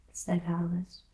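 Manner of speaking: usual speaking voice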